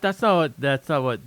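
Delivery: ordinary delivery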